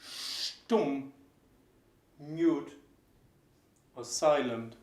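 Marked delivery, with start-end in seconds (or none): 0.0-1.1 Stumm with a clear sh sound at the start; German sht. Shown as a demonstration. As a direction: say Stumm as shtumm. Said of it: "this is how you pronounce the S T at the beginning".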